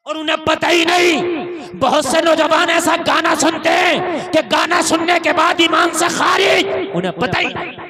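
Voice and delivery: loud preacher's exhortation; loud sermon cry; fiery preaching. Acted. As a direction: thunder it to the crowd. Preacher at full force.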